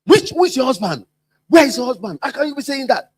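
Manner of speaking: hammed-up indignant retort; mock indignant outburst. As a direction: play the offended boss, half-hearted. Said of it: "You become wild".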